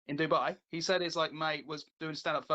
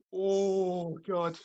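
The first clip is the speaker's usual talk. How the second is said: drawn-out mock "oh"; imitating shocked crowd; 3 dB louder than usual. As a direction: groan like an audience taken aback. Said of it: "everyone just like".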